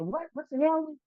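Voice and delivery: high, startled what the hell; retold shocked outburst. Confused teen during arrest.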